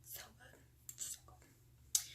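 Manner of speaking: very soft, voiceless whisper; hushed, indulgent aside; savouring whisper. Real, not acted. Savouring remembered chili fries, whispered.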